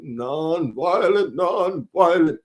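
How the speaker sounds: slow, stretched-out orator impression; mock solemn speech voice, very slow; Martin Luther King. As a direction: draw each syllable out like a civil rights preacher. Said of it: "do my Martin Luther King voice".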